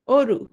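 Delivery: অরু with a closed o, as "oru"; closed vowel demo. Shown as a demonstration. Teacher shows students her daughter's name, closed o in অরু. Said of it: "এটা হচ্ছে সংবৃত উচ্চারণ".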